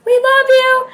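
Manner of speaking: high, loud scream of his name; fan scream. Retold or imitated. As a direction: scream to be heard over the crowd. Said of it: "and I screamed".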